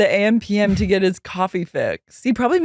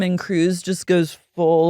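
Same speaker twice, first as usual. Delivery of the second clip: normal talking voice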